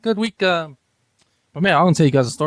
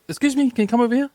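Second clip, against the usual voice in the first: higher-pitched, mildly indignant summons; mad bus driver; 8 semitones higher than usual. Retold or imitated. Passenger retells the bus driver calling a man back, mock annoyed. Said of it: "pretty mad".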